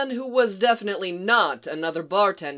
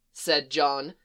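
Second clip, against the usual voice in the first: ordinary delivery, nothing marked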